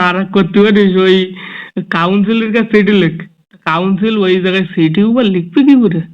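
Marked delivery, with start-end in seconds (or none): none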